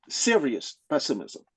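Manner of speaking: normal talking voice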